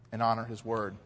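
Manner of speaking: ordinary talk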